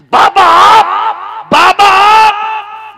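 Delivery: very high, very loud, drawn-out cry of disbelief; astonished cry. Acted. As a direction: stretch each word in stunned wonder. Woman shocked to see saint. (चौंककर लंबी चीख)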